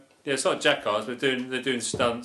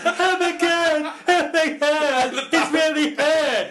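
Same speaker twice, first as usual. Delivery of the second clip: high, slow, mocking wail; hammed-up mock crying; mock bawling; 4 dB louder, slower than usual. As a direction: imitate a sobbing stuntwoman. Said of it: "she starts bawling her fucking eyes out".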